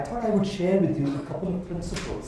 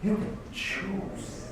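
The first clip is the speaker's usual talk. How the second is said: You can choose said again very slowly and quieter; hushed, drawn-out echo of You can choose; soft slow repeat; 6 dB quieter, much slower than usual. Shown as a demonstration. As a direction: drop the voice and linger on You. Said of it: "repeated softer".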